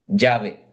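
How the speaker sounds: Yahvé low and firm as yah-VEH; repeating Yahvé. Shown as a demonstration. Teacher restating Yahvé so students remember. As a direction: drive home Yahvé for the class. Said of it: "el nombre es Yahvé".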